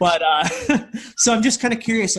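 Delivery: real chuckle through the words; laughing. Real, not acted. Host resuming the question after a joke, laughing.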